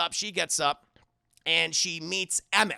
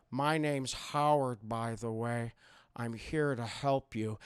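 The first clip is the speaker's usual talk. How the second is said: lower, slow, soft captor voice; creepy captor Howard; 6 semitones lower, 4 dB quieter than usual. Retold or imitated. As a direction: speak low and slow like a calm jailer. Howard voiced in retold plot.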